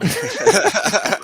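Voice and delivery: loud high laugh; burst of laughter. Real, not acted. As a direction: crack up out loud. Reacts to Russian accent clip.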